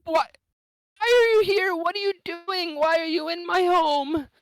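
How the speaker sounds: high, loud frightened questions; panicked woman in her home; scared lady confronting intruders. Acted. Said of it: "but she says".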